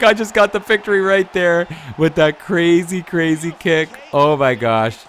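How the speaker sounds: real gamer hype over a buzzer-beater win; excited victory shout. Real, not acted. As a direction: yell it out as the kick lands. Winning fight with last-second kick.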